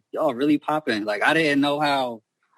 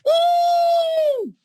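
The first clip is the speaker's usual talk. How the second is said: very high, loud "woo"; high loud whoop; 5 dB louder than usual. Real, not acted. Brother reacting to his own photo story, high whooping. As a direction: let out a big high-pitched whoop.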